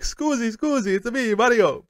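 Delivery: high-pitched cartoon Mario-style greeting; mock Italian character voice, high; friendly Italian stranger. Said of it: "expecting this guy to go like".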